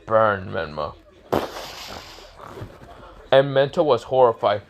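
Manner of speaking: plain reading voice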